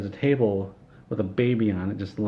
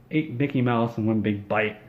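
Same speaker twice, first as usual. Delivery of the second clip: ordinary delivery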